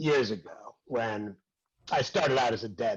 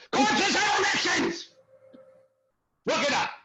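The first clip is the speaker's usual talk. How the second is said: defiant shout; 4 dB louder than usual. Acted. Acting teacher justifying himself to class, defiant shout. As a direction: shout it out in self-defence.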